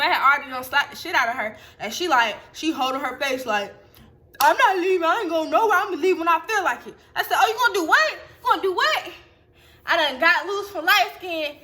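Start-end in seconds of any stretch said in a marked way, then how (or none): 4.4-6.9 higher-pitched imitation of stubborn refusal; higher, insistent retold protest; mock defiance. 7.4-9.1 high-pitched, laughing disbelief; retold outraged comeback, pitched high; incredulous challenge. Retold challenge during the quarrel.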